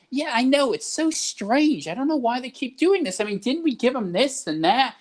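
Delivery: butter them up, pitched high and sweet; agreeable persuader. Retold or imitated. Agreeing with a boomer to win trust, high sugary voice. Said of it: "And be like".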